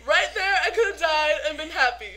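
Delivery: loud, high, giddy declaration; overjoyed gushing. Real, not acted. Fan who could have died happy, loud overjoyed gushing. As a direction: gush with all your fan excitement.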